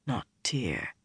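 low, very soft murmur. A narrator's reading. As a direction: murmur it under your breath. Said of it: "he murmured".

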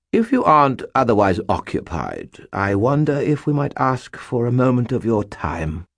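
haughty British warden bowing. A narrator's reading. Butters' nobleman voice.

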